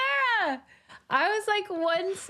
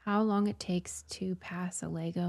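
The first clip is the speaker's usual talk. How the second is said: whispering; 5 semitones lower, 6 dB quieter than usual. Real, not acted. Whispered joke aside.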